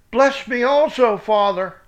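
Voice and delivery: high, loud pleading cry; bitter cry. Acted. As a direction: beg like a son robbed of everything. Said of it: "he cried with a cry great and bitter".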